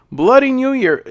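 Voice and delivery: title blurted out high with a groan of disbelief; exasperated. Real, not acted. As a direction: reviewer tossing out the title as the punchline, exasperated. Punchline of what could go wrong.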